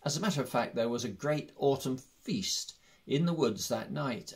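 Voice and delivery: ordinary delivery, nothing marked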